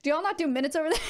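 laughing while asking; cracking up mid-question. Real, not acted. Laughing at a viewer's metric question.

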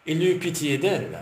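ordinary delivery, nothing marked

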